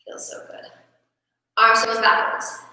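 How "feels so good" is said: relieved whisper. Real, not acted. Enjoying the stretch.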